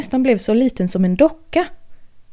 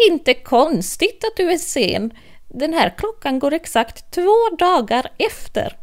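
high, knowing Hatter voice, slowing at first; a know-it-all with a broken watch; Mad Hatter; 7 semitones higher than usual. Acted. Hatter explaining the rabbit's watch, high and knowing.

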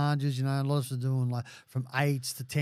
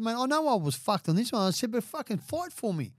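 raised, higher voice pressing the lawyer; retold heated plea; 9 semitones higher than usual. Demanding the solicitor fight for him, heated and higher. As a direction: push hard, imitating his own frustration.

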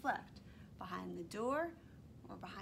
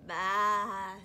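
sheep bleat; 9 dB louder than usual. Acted. Sheep sound in story.

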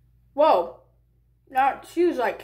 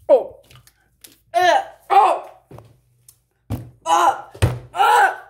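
loud, high pained grunts; straining against extreme sourness; eating sour candy; 6 semitones higher, 7 dB louder, slower than usual. Real, not acted.

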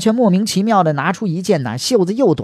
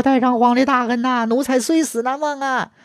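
mock wailing; 7 semitones higher than usual. He Shen mourning the dead emperor, high mock wailing. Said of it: "和珅放声大哭啊".

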